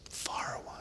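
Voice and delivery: whispered fire away; whispered go ahead; conceding guest. Retold or imitated. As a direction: hand him the floor softly. Guest gives in.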